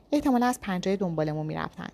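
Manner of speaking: ordinary delivery, nothing marked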